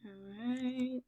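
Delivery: soft hushed whisper. Acted. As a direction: hush them down gently. Calming kids after sitting down.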